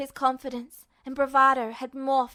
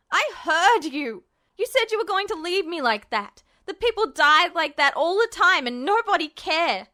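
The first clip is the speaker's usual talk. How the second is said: put-on raised, rising angry boy's voice; Ed's furious rant; 4 semitones higher, 4 dB louder than usual. Captive Ed rants at Justin, raised and furious. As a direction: hurl it at him, voice climbing toward a scream.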